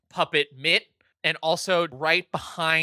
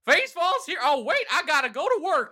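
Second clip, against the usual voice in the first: high puppet voice; 12 semitones higher, faster than usual. Acted. Puppet introduces himself.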